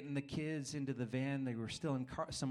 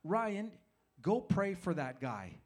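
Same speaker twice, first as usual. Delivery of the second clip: voice of the Lord; 4 semitones higher, 10 dB louder, slower than usual. Retold or imitated. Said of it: "I sensed that the Lord said".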